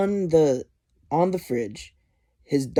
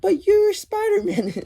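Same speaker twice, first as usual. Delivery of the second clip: put-on very high-pitched little child voice; put-on tiny voice, way up high; May Parker; 4 dB louder than usual. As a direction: squeal it like a tearful kid. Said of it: "and she's like".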